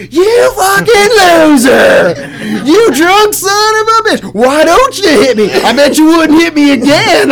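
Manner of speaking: high, drawn-out mock screaming; hammed-up furious taunting. Interviewed woman re-enacting her taunts at drunk husband, hammed-up fury. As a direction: scream it like a belligerent woman daring him.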